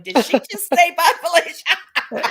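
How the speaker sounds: high-pitched pretend astonishment, breaking into laughter; mock shock with a laugh; imagined child's disbelief. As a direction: imitate a stunned child, laughing through it. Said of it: "I could just see her in a situation like that going".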